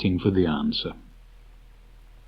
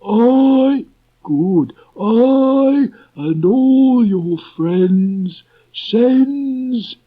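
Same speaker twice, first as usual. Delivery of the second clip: very high, loud, slow donkey voice; a gloomy donkey stumbling through verse; Eeyore reciting his poem; 11 semitones higher, 7 dB louder, much slower than usual. Acted. Eeyore reads his farewell poem to friends, slow and mournful.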